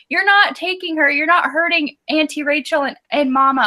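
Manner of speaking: put-on high, earnest little-boy defiance; child protecting his aunt and mama with a toy pistol; brave little boy. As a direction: play a small boy defending his family. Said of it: "and he's like".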